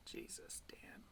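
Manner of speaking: whispered aside, no voice at all; whispered "Jesus, Dan". Real, not acted. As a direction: breathe it out under your breath. Aside reacting to the clip.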